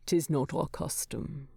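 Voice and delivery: soft, gentle reassurance; reassuring. Acted. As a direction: ease his worry kindly. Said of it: "he reassures".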